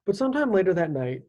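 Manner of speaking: usual speaking voice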